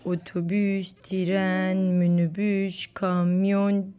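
slow, low, word by word; playful countdown. Pretend. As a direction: draw out each word before the beep. Nose-beep game, slow build-up to beep.

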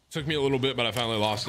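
ordinary delivery

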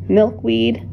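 plain reading voice

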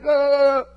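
high, held cry of mock dismay; drawn-out high "aah" in reaction; loud appalled "ah". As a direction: let out a long, loud groan of horror. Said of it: "we're just like".

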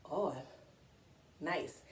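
ordinary delivery, nothing marked

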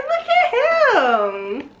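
slow, high, giggling; delighted laugh through words. Real, not acted. Delighted at a gift.

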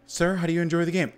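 high, quick, nervous interviewer; mock polite interviewer. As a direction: play the worried booth attendant asking questions. Interviewer at E3 asking him.

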